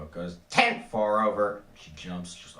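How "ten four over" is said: sudden loud, raised radio burst; police radio voice. Acted. Cop car radio breaking in suddenly, loud dispatcher voice. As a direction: bark it like a dispatcher over the radio. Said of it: "from the cop car radio".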